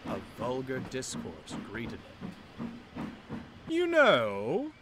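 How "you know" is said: high, loud opening to draw attention; said loudly. Acted. Loaned engine speaking loudly to another engine, pointedly. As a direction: announce it so everyone hears. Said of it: "said loudly".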